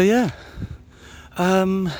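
usual speaking voice

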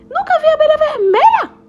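high, loud outburst on abelha; high astonished bee shout; loud protest. Acted. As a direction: exclaim in disbelief. Luke protests loudly.

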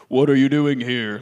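low, loud booming question; audible voice of God. Acted. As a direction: boom it out as God's voice. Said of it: "would have just audibly said".

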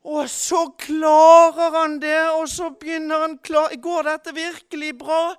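loud, high, driving sermon voice; fervent preaching. Acted. Preacher to congregation, retelling Peter on the water at full force.

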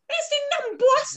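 put-on very high, mangled American accent; mock a hopelessly bad American accent. Reviewer mocking an actor's accent, bad American accent. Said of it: "never heard an American accent before".

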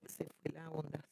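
ordinary talk, nothing marked

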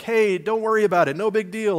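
high and loud, shrugging it off; breezy brush-off. Retold or imitated. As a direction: brush it away lightly, too quickly. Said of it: "we just go like".

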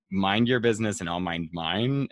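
normal talking voice

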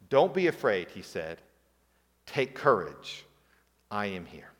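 calm the frightened disciples softly; reassuring Jesus. Retold or imitated.

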